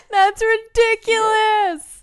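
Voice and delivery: high, slow, laughing disbelief; giggling, delighted disbelief. Real, not acted. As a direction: squeal it through laughter.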